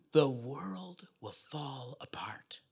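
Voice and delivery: slow mock-dramatic whisper. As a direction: hush it like a fake doom. Mock fear, whispered slowly.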